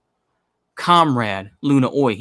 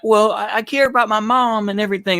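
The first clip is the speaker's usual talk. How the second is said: mocking whiny activist; 7 semitones higher than usual. Ranting host mocks radicals who miss their mom, whiny mock voice. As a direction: sneer it like a sentimental poser.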